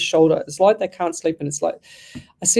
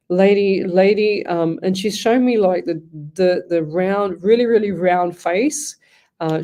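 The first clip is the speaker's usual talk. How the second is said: normal talking voice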